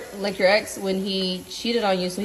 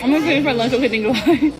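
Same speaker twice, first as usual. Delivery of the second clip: laughing; 4 semitones higher, 6 dB louder than usual. Real, not acted. Tickled, laughing at lunch.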